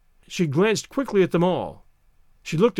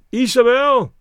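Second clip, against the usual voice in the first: loud, high call of a name; 6 semitones higher, 6 dB louder than usual. A narrator's reading. Friends in the garden summon Isabel, calling loudly. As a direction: call up to someone indoors.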